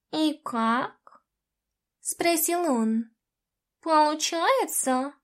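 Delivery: slow, odd cat voice; a sly talking cat, drawn out; cat spirit. A narrator's reading.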